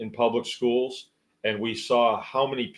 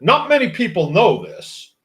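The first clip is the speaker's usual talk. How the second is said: higher and louder, puffed-up know-it-all; mock smug boast; 6 semitones higher, 7 dB louder than usual. Mocking people who brag about secret knowledge, smug. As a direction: lord it over them like a braggart. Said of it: "people say that".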